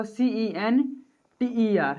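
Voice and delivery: letter by letter, very slow: सीईएनटीईआर; slow dictation spelling. Shown as a demonstration. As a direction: spell सीईएनटीईआर out for the students to copy. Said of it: "और American English में क्या होगा".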